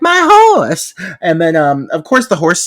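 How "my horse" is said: put-on high, loud little girl's wail; mock child's cry. Imitating the little girl who owns the horse, high wailing cry. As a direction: cry it out high like a panicked kid. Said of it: "She's like".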